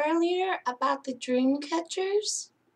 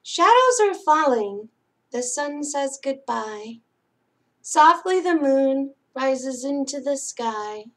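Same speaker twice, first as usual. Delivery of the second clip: louder, lilting read-aloud for little ones; singsong picture-book reading; bedtime story reading; 2 semitones higher, 6 dB louder than usual. Acted. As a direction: read it like a lullaby to sleepy kids.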